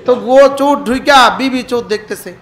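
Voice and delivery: normal talking voice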